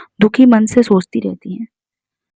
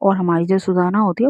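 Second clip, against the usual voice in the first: ordinary delivery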